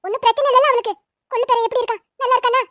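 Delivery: loud, high, excited grandmother; overjoyed relief. Acted. Grandmother hearing the missing girl is found, overjoyed. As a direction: gush with relief and eager questions.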